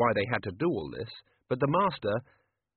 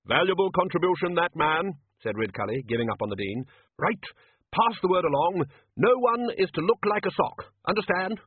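bluff wizard voice; 7 semitones higher, 3 dB louder than usual. Acted. Ridcully voiced.

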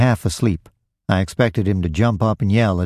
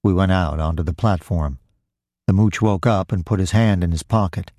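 ordinary delivery

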